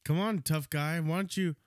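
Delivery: put-on cocky kid daring a fight; taunting tough guy. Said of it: "and he said".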